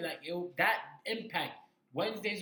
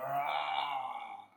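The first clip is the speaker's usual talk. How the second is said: mock roar. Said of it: "have Strowman roar like".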